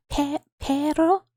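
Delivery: stumbling try at a trilled R in "pero"; attempted rolled R. Shown as a demonstration. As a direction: start "pero", restart, reach for a rolled R. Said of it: "don't even know how to try".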